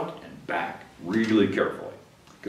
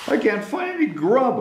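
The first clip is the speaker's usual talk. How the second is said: crying beetle; 10 semitones higher than usual. Acted. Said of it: "a voice cried".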